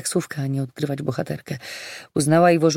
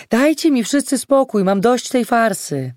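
raised, higher, exasperated yell; fed-up outburst; 6 semitones higher, 5 dB louder than usual. A narrator's reading. Olga yells back at the man as she leaves, exasperated outburst. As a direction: shout that you have had enough.

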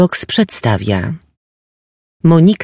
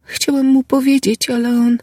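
put-on distressed young woman; 13 semitones higher than usual. Girl breaking off in pain.

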